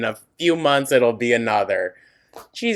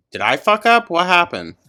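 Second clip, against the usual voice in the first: alarmed; 8 semitones higher, 6 dB louder than usual. Real, not acted. Video glitch surprise.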